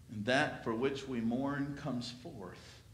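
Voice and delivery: ordinary delivery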